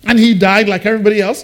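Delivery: ordinary talk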